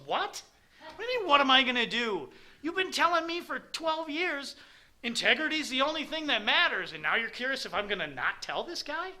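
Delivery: high, loud, incredulous daughter's voice; indignant daughter. Retold or imitated. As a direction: mimic his daughter outraged that he even asked. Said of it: "And she looks at me and goes".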